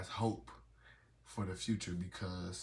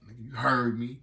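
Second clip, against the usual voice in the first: annoyed retort; 3 semitones higher, 8 dB louder than usual. Retold or imitated. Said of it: "I be like".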